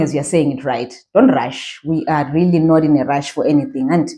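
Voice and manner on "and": ordinary talk, nothing marked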